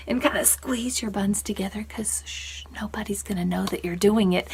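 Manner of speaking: secretive unvoiced whisper. Teacher confiding a trick to the class, whispering. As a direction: let them in on a secret.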